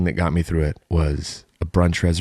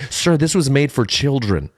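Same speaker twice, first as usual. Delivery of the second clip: mock staff scold; 7 semitones higher than usual.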